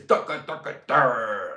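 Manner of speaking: rhythmic mock chant; chanting a mock tune.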